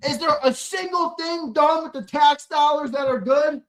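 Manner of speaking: rising shout about wasted tax money; angry rant. Real, not acted. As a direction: yell it out, fed up and furious. Angry rant about state taxes.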